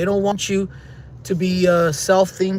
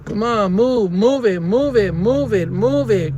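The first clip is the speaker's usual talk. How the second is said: repeated impatient move it at stuck cars; impatient urging; slower than usual. Real, not acted. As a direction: hurry the drivers up, fed up. Urging stalled drivers at green light.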